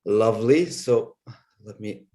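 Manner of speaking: normal talking voice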